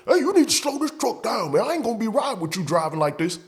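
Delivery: high falsetto whiny coworker voice; mocking coworker. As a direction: squeak it out like the nagging buddy. Coworker complaining about speeding.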